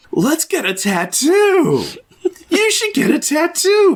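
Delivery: hammed-up party-girl push; mock excited friend. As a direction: sell the tattoo like a wild friend. Mocking the friend's bad advice.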